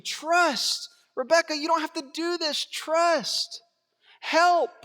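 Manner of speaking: impassioned plea. Real, not acted. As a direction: plead with each character to trust.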